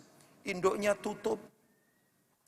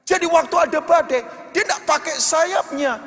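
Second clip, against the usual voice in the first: loud, fervent sermon over music; loud, higher impassioned preaching; full-force preaching; 4 semitones higher, 6 dB louder than usual. Acted. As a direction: preach it out, pushing the congregation. Preaching climax to amen call.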